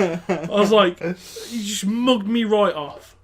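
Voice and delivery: loud, high indignant cry through laughter; indignant outburst. Retold or imitated. Waiter retelling outburst at his sister, loud mock outrage. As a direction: blurt it loudly, stretched out in mock outrage.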